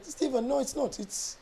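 soft, high-pitched German client imitation; German accent. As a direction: protest softly in a German accent. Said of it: "That's the best German accent".

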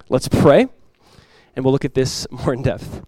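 normal talking voice

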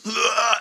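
high, sudden demon noise; imitated demonic groan. Preacher imitates the demon in the man, high groan. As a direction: mimic the thing inside him. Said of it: "this thing was talking out of his stomach".

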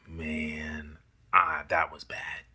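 hushed, low self-reproach; whispered regret. Retold or imitated. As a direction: mutter it to yourself in regret.

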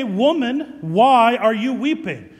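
loud, solemn call to the weeping woman; loud angel question. Acted. Angels questioning the weeping Mary, loud and solemn. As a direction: boom it out like an angel.

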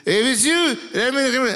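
high excited Peter voice; eager Peter. Retold or imitated. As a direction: rush it like he can't wait. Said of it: "I bet you Peter says".

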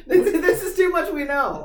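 high-pitched, laughing objection; laughing protest. Real, not acted. Co-host overwhelmed by a friend's confession, laughing protest. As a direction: protest through real laughter.

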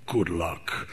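very low, soft menace; a cold final warning; villain's parting line. Acted. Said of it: "And then the voice goes".

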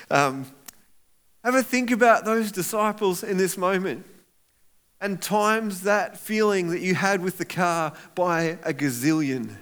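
ordinary delivery

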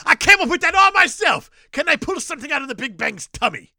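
high shouted mock boast; excited shout. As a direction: shout it like a giddy director. Voicing director, continued bit.

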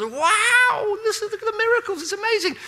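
amazed crowd. Retold or imitated. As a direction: gush like a crowd dazzled by miracles.

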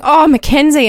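lightly hammed-up lovestruck sigh of admiration; mock swooning. Imitating their swooning over a player.

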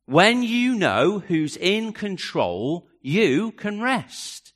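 slowly, each word weighed; slow and deliberate. Acted. Preacher pressing the point home, slow and deliberate. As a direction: drag it out so it sinks in. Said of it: "repeated line, slowed down".

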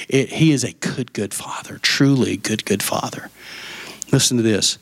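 ordinary delivery

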